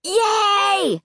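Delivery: high, drawn-out gleeful yell; screaming. A narrator's reading. Boy wins the argument, screaming with glee. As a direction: cheer at the top of the pitch, not the volume. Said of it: "Luke screamed".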